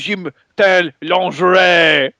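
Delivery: high, hammed-up French accent. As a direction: parody a French reality show. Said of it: "what if Jersey Shore was all French?".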